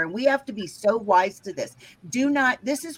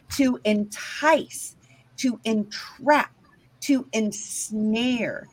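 slow, weighty list; much slower than usual. Acted. Listing the ways to deceive.

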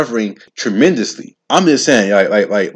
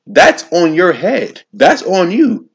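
high, slow and pointed; slow, raised, accusing emphasis; emphatic blame; 8 semitones higher, 4 dB louder, much slower than usual. Real, not acted. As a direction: lay the blame squarely on them. Blaming reckless partiers.

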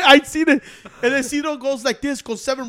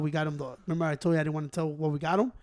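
ordinary delivery

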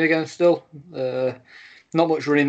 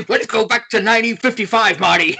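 higher, louder, hammed-up Doc Brown; a bad but eager Doc Brown, calling Marty back to fifty-five; Doc Brown impression; 8 semitones higher, 5 dB louder than usual. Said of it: "that was an awful Doc Brown impression".

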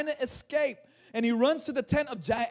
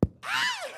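very high, short mock squeal; sudden falling comic shriek; imitated high shriek. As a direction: squeal like her, hammed up. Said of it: "And she's like".

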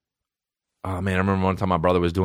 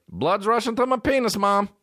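put-on high-pitched, proud-sounding little-kid call; imitated kid announcing. Cousin walks through kitchen telling mom, high mock-kid announcement. Teller repeats kid's announcement.

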